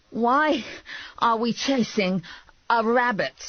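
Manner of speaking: high, broken into gasped bits; out of breath. A narrator's reading. Man bent over after a chase, out of breath. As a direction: pause between phrases as if winded. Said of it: "sucking air".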